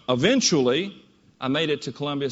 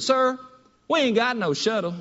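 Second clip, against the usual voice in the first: higher, blunt Southern clerk voice; higher Southern woman, matter-of-fact; southern female clerk; 5 semitones higher than usual. Retold or imitated. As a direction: flatly tell him there's no shuttle, Southern style. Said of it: "very southern female voice".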